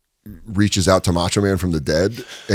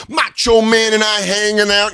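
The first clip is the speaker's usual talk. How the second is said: mocking rapper; 10 semitones higher than usual.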